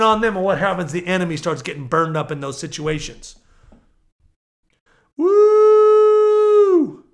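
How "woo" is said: long, high, loud "woo"; piercing, held whoop of praise; exultant whoop. Real, not acted. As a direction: let out a sustained high whoop.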